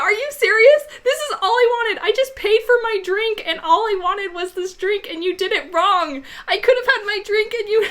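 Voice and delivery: high, loud tearful rant; hammed-up crying. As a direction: imitate a wailing meltdown. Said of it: "And I start crying".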